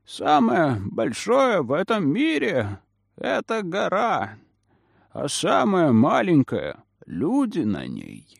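put-on slow, swooping old man's voice; indifferent old man. A narrator's reading. Old man answering the riddle, slow aged voice. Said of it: "равнодушно произнёс старик".

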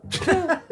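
short amused laugh; a burst of mocking laughter. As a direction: laugh out at him, high and bright.

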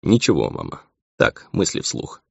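lightly dismissive, slowing at the end; carefree. A narrator's reading. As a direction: brush it off casually. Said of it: "он уже улыбался беззаботно".

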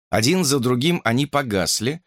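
ordinary delivery, nothing marked

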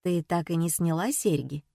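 usual speaking voice

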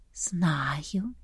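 breathy whisper like wind; gusting windy whisper. A narrator's reading. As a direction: whisper it like a breeze. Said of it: "кивнула оленья голова". (шёпот, как шелест ветра)

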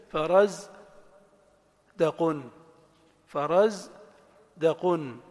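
فرز as faraz, long pause, دقن as daqun; syllable-by-syllable. Shown as a demonstration. Teacher matches example to rhythm, slowly split. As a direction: beat out فرز and دقن as separate chunks. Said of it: "التنغيم ومعرفة المقاطع".

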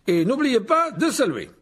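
high, loud, slow reminder; pompous teacher. A narrator's reading. Lockhart reminds duellists to bow, loud and drawn-out. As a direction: call it out slowly to the hall.

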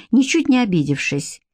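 ordinary delivery, nothing marked

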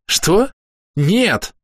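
high-pitched indignant denial; sharp rise in pitch, affronted. A narrator's reading. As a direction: reject the idea as absurd. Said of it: "возмущённо сказала Хейли".

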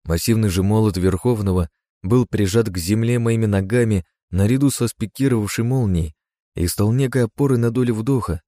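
ordinary delivery, nothing marked

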